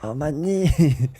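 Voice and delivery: put-on slow, soft groan in a thick accent; imitating a heavy accent, drawn out; Tommy's thick accent. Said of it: "Tommy has a thick accent".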